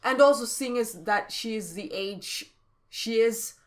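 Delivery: normal talking voice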